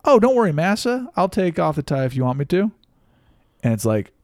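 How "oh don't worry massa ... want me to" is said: a hint of an exaggerated servile tone, higher; mocking deferential plea; lightly hammed-up subservience. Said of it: "you're like".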